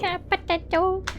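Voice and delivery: high, soft little plea; silly catchphrase voice. Acted. Game master repeating the dog joke, small high voice. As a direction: squeak it quietly, playfully.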